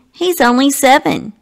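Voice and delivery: ordinary delivery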